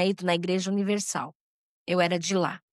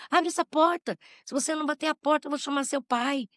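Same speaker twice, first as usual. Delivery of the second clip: higher, urgent call through a door; mother calling through door; 6 semitones higher than usual. Retold or imitated. Mother banging on the bathroom door, higher urgent call. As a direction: raise the voice to demand she open up. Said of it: "bateu na porta".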